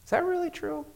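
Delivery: the quiet inner voice of truth, gently questioning; gentle inner voice. Retold or imitated. Pastor recalls inner voice asking him, gentle and soft. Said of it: "I heard this voice say".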